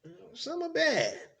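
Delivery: put-on high-pitched little kid protesting to dad; questioning child. Small son doubting his dad, in a high childlike voice. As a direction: push the pitch up into a child's objection. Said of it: "I remember asking him".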